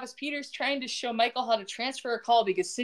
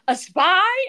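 high, loud disbelieving question; mock incredulous; 10 semitones higher, 8 dB louder than usual. Woman questioning him once more, hammed-up incredulity. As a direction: repeat it in hammed-up astonishment, pitch leaping.

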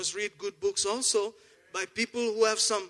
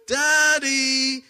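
"Daddy" called out louder and higher, held long; emphatic cry; 6 semitones higher, 9 dB louder than usual. Shown as a demonstration. Preacher to congregation, echoing his own word in a loud joyful call. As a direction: ring out "Daddy" with joy. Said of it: "became your daddy".

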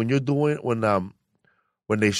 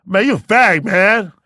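put-on kid yelling; 6 semitones higher, 6 dB louder than usual. Kid yells back at him.

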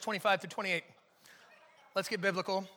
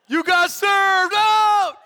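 drawn-out playful shout, pitched up; mock taunt shout; 8 semitones higher, 11 dB louder, much slower than usual. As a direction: hype it like a dance-battle taunt. Comic dance-battle taunt.

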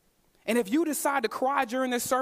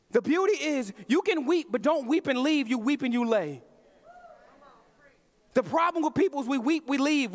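preaching at full force; 6 semitones higher than usual. Acted. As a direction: urge the congregation, building in fervour. Preaching climax, urging the church.

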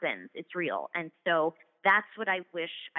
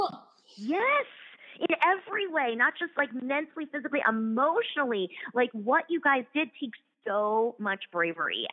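excited gushing; 7 semitones higher than usual. Real, not acted. Praising guest's courage.

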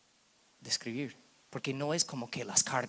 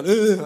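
loud mock bawling noise; loud, ugly-cry groan, imitated; imitated hard sob; 5 semitones higher, 14 dB louder than usual. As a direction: act out a big snotty sob. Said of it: "fuerte, como mocos y todo".

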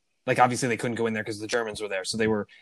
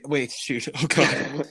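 usual speaking voice

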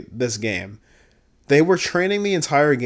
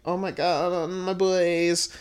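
imitated character; 4 semitones higher, 4 dB quieter, slower than usual. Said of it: "Xion's like".

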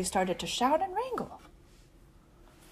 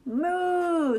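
long, raised moo; cow moo; 5 semitones higher than usual. A narrator's reading. Masked cow to the guessers, mooing. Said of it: "says the cow".

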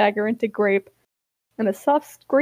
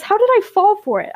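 high, loud, incredulous question; acted high-pitched protest; indignant retort; 9 semitones higher, 5 dB louder, faster than usual. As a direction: throw it back, offended.

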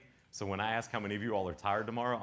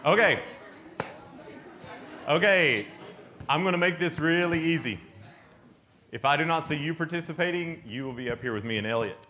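louder, higher stage call; loud announcing; 6 semitones higher, 5 dB louder than usual. Real, not acted. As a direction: project to the whole room. Calling a crowd to order.

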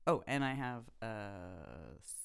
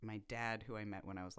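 usual speaking voice

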